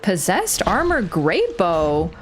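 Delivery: slow, awed reading of the item name. Real, not acted. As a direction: savour each word with wonder. Reading new item name in awe.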